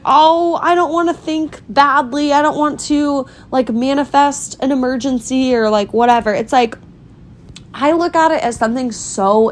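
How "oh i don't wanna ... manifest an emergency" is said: high, whiny imitation of a worrier; mocking worried people. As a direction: mimic an anxious, superstitious friend. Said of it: "people are like".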